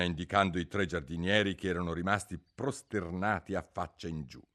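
plain reading voice

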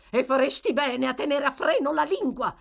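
wife telling husband to shut up; angry scolding. A narrator's reading. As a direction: scold him sharply. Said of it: "esclamò la signora Bumble".

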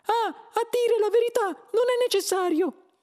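very high, loud mouse voice; Frankie. A narrator's reading. Mouse tells old man he's not needed, squeaky voice. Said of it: "disse il topo Frankie".

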